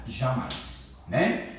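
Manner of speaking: ordinary delivery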